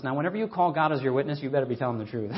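usual speaking voice

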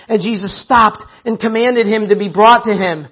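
ordinary delivery

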